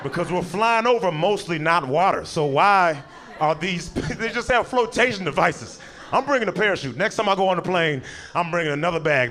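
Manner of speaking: ordinary talk, nothing marked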